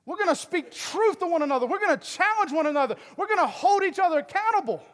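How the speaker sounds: high, loud rallying declarations; full-force preaching. Acted. Pastor rallying the congregation to accountability, full-force preaching. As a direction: drive each phrase home from the pulpit.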